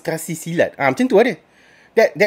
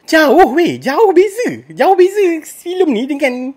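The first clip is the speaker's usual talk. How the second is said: higher, louder, each jauh hammered home; exasperated emphasis; 8 semitones higher, 5 dB louder, slower than usual. Real, not acted. Film reviewer telling viewers the gap is huge, exasperated and loud. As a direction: press the gap hard, incredulous.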